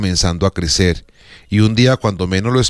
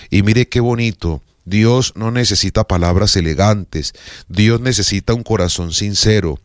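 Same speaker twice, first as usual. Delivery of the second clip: ordinary delivery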